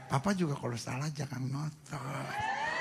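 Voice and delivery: soft, slow, low plea; tender wife. Acted. Wife leaning on husband's chest, tender gentle reply. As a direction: answer him gently, drawing it out.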